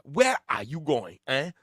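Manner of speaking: slow, high Nigerian accent impression. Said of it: "I probably can't do that accent".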